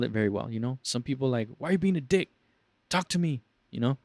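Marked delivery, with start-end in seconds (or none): 1.6-3.4 high, indignant demand; annoyed customer. Retold or imitated. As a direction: needle the silent agent. Customer reacting to silence.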